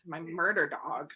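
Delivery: ordinary delivery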